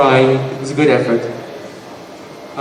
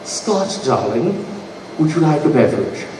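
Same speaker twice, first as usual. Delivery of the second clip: soft, low British mother; gracious British hostess; 3 semitones lower, 7 dB quieter than usual. Acted. Comic imitates his mother offering a guest a drink, gracious and soft. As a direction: play the polite British host, softly. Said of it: "the gracious host".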